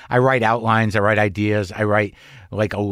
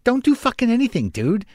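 exasperated inner voice; 9 semitones higher than usual. Retold or imitated. Inner voice telling self off.

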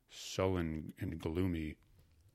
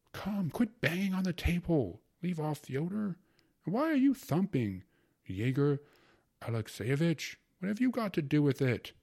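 scolding mother; 9 semitones higher, 5 dB louder than usual. A narrator's reading.

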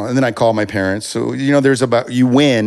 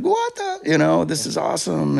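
amazed disbelief; 9 semitones higher than usual. Retold or imitated. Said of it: "it's like".

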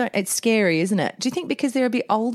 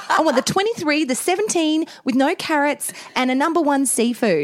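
higher-pitched mock customer order; efficient diner ordering; 6 semitones higher than usual. Diner orders by numbers at restaurant, mock efficient tone. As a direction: rattle off a menu order as a customer. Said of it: "you are efficient and ordering and you go".

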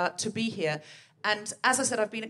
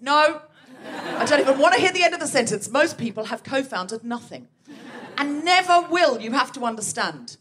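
louder, fast mock interruption; mock outburst; 2 semitones higher, 8 dB louder than usual. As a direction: cut her off in mock outrage. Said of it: "I said".

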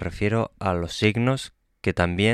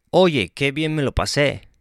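higher and louder happy exclamation; exclaiming; 6 semitones higher, 4 dB louder than usual. Shown as a demonstration. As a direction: burst out with delight. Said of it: "Exclamación al principio y al final".

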